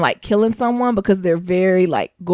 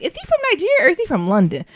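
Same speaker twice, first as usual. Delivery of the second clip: puzzled; 8 semitones higher, faster than usual. Retold or imitated. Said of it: "I was like".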